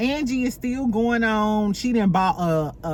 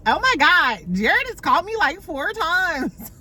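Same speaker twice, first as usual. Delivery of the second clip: mock girly fuss; 8 semitones higher than usual. Brittany fussing about Jared calling.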